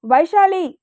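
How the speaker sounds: Vaishali shouted high and loud; loud call. A narrator's reading. Prasanthi summons Vaishali, a loud commanding shout. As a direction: yell her name across the house. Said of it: "గట్టిగా అరిచింది ప్రశాంతి".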